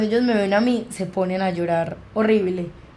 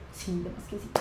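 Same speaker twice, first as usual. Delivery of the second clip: hushed agreement; 13 dB quieter than usual. Retold or imitated. Agreeing after hearing ghost.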